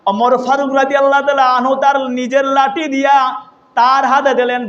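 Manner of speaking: ordinary talk, nothing marked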